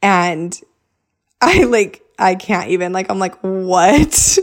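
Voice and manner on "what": stunned, drawn-out question; disbelieving. Retold or imitated. Wife hearing of the land offer, disbelieving. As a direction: re-enact being floored, voice lifted a little.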